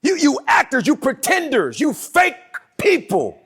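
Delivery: loud, slow accusation; Jesus calling them out; preached rebuke. Acted. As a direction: drive each "you" home like a rebuke. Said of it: "He says".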